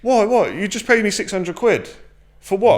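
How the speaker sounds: high, indignant disbelief; a hint of a stunned client; shocked client. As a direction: mimic the client's outraged what. Client's shocked reply acted.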